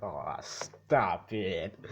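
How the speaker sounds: drawn-out, very slow bashful "Aw, stop it". Retold or imitated. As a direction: stretch "stop it" out slowly like a flattered kid. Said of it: "but he says it, like".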